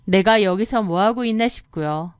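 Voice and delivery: ordinary delivery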